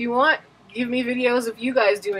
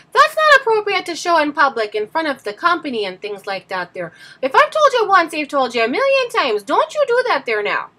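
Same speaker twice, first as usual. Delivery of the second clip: scolding character voice; 4 semitones higher than usual. Acted. Scolding a boy over things left on the floor, in a high put-on voice. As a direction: start each scolding high and slide down. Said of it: "all those characters were that I was playing".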